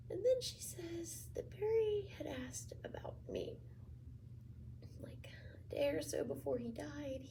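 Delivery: soft, whispery, halting confession; quiet and choked, with long pauses; hushed grief. Acted. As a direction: let the voice thin to near whisper, guilt-laden. Confessing guilt over cousin's death.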